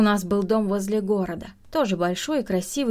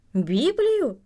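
higher, slower one-word question; astonished echo; 6 semitones higher than usual. A narrator's reading. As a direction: repeat it in open disbelief. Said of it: "она удивлённо спросила".

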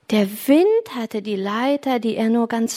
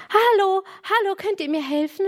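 high call to catch someone's attention; 9 semitones higher than usual. A narrator's reading. Boy on the roof calls to two girls, high call. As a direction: call out high for help, only lightly raised in volume. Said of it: "rief Jürgen".

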